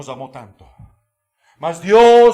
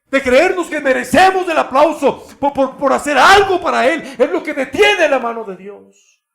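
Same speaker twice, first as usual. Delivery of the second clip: loud, high, impassioned sermon; full-force pulpit preaching; fiery preaching; 8 semitones higher, 5 dB louder than usual. Acted. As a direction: preach it hard to the congregation.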